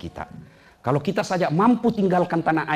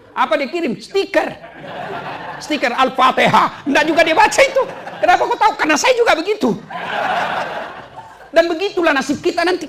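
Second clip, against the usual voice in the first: laughing while preaching; 4 dB louder than usual. Real, not acted. Joking about WhatsApp stickers.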